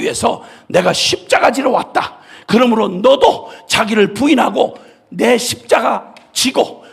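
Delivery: loud, fervent preaching; full-force preaching. Acted. Preacher pleading loudly with the congregation. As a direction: preach it out with all your strength.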